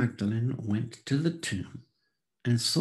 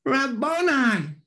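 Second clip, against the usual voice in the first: high, loud, slow cry of recognition; joyful cry; 13 semitones higher, 6 dB louder, slower than usual. A narrator's reading. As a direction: cry out the name in sudden joy. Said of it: "cried out in Aramaic".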